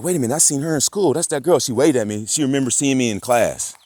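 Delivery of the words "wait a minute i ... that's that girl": higher, louder, quick wait a minute; retold childhood excitement, lively; excited recognition. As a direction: rush it out as a kid spotting her. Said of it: "and I was like".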